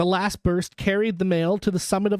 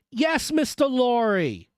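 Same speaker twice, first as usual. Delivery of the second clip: high, drawn-out reply; calling back; 7 semitones higher, slower than usual. A narrator's reading. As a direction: hail back across the distance. Messenger calls back through mist.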